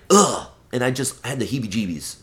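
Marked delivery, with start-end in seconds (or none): 0.1-0.5 disgusted groan. Retold or imitated. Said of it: "And I was like".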